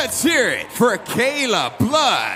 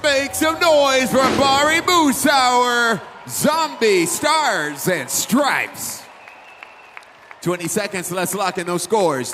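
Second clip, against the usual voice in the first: booming crowd call, drawn out; dueling hype announcers; 2 semitones higher, 5 dB louder, slower than usual. Real, not acted. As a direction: whip the arena crowd up.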